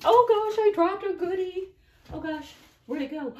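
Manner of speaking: real alarm at dropping a piece; dropped something. Real, not acted. Crafter losing a piece to viewers, startled high yelp.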